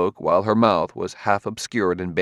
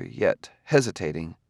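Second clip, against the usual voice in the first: plain reading voice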